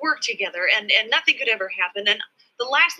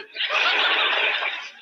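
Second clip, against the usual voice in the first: high laugh. Real, not acted. Laughs after confession.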